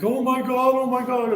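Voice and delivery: high, frantic woman's squeal; panicked shriek. Retold or imitated. Lady running out of the gas station, high panicked shriek.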